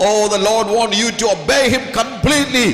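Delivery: fervent slow shout; shouted exhortation. Real, not acted. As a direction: drive each word home to the crowd. Preacher exhorts the crowd.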